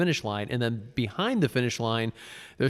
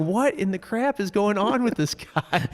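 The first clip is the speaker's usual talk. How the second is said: baffled; 9 semitones higher than usual. Retold or imitated.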